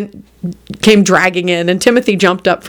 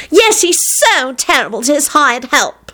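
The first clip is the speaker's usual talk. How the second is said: high, drawn-out retort; snapped; 8 semitones higher than usual. A narrator's reading. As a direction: snap back with stretched words. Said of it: "snapped Solange".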